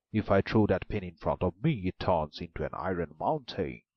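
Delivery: loud, boastful ogre voice; the ogre boasts of his magic; an ogre explains his magic pin to the maiden, loud. A narrator's reading. Ogre's voice.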